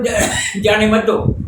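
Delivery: normal talking voice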